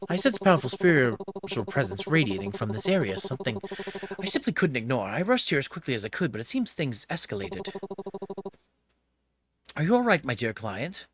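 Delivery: mannered, concerned and swooping in pitch; concerned spiritual helper. Acted. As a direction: play the formal psychic rushing to a client's aid. Voices spirit-sensing helper Haruki.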